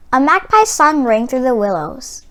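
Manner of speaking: ordinary delivery, nothing marked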